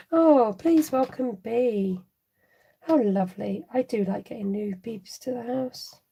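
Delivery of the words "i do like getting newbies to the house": ordinary talk, nothing marked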